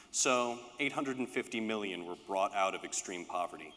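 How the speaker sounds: ordinary talk, nothing marked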